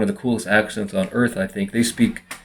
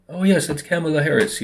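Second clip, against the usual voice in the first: higher-pitched mock British accent; imitation Brit, pitched up; 6 semitones higher, 3 dB quieter than usual. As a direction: put on a polite British accent. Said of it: "someone from Britain would say".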